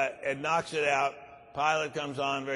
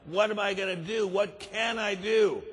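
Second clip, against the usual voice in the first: distraught wife; 6 semitones higher than usual. Acted. Distraught wife tells husband.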